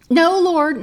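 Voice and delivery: NO, Lord belted high and loud; loud mock refusal. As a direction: slam the door with No. Mocking refusal to God.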